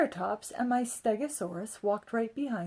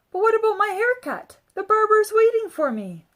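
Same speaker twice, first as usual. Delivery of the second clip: put-on high, eager child's voice; little boy pleading; a child's anxious question; 11 semitones higher, 6 dB louder than usual. A narrator's reading.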